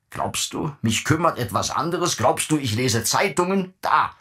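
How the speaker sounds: father mocking his son, then throwing the paper; scornful outburst. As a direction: fling the questions at him.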